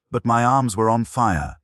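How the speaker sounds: ordinary delivery, nothing marked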